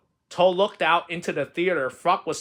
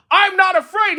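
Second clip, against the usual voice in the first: high, loud, protesting shout; 9 dB louder than usual. Acted. Trembling Frog insists he is brave, high loud shout. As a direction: shout it out to prove your courage. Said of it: "he shouted".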